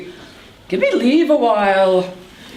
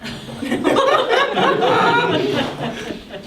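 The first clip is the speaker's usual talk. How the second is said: high cackle; 11 semitones higher than usual. Acted. Nurse cackles.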